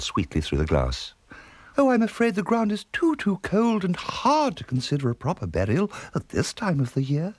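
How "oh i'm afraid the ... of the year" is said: high, sugary coo. Acted. Unflappable aunt refusing the imploring man, cooing sweetly. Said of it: "she cooed".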